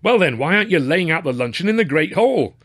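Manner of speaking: high, indignant demand; exasperated fatherly ticking-off; scolding father. A narrator's reading. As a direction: demand an answer from an idle son. Father scolds his son.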